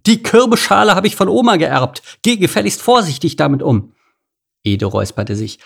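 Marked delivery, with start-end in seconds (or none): 0.0-3.9 much higher, loud sharp scream at a worker; shrill shout. A narrator's reading. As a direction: shriek at the clumsy one. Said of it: "spitzen Schrei der Chefin".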